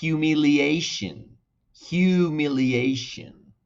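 slow, careful humiliation with -shun ending; English pronunciation demo. Shown as a demonstration. Teacher drilling humiliation for Spanish speakers, slow and careful. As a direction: say humiliation slowly for repeating. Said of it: "Humillación sería".

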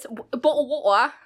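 mock British with dropped Ts; stumbling attempt at British; fake British accent. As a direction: put on a clumsy British accent. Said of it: "do a fake accent".

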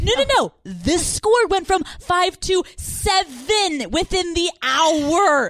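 high, loud insistent; hammed-up frantic girlfriend; shrill mock girlfriend. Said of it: "She's like".

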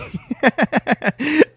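loud, high-pitched burst of laughter; high cackle. Real, not acted. Joking about conspiracies, loud high cackle. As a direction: cackle at your own joke.

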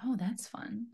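breathy, hushed sultry whisper. Pretend. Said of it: "my most sultry voice".

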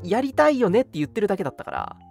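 usual speaking voice

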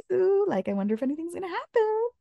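high, quick giddy musing; hopeful giddy thought. Retold or imitated. Bride on the honeymoon morning, giddy and hopeful. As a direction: bubble up with hopeful anticipation.

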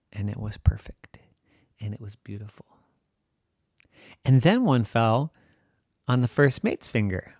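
usual speaking voice

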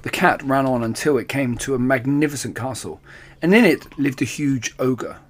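usual speaking voice

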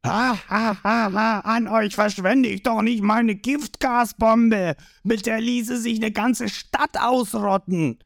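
high, laughing mockery; boastful villain cackle into speech; gloating villain. A narrator's reading.

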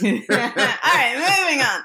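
said through a laugh. Real, not acted. Host moving the podcast along after teasing, laughing.

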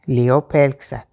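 usual speaking voice

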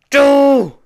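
high, loud accusing shout; furious scream. Acted. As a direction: hurl the word at her like a curse. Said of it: "schrie sie".

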